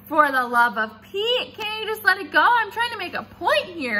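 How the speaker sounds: put-on girl exasperated with her teacher's corrections; exasperated outburst. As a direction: throw your head back and vent.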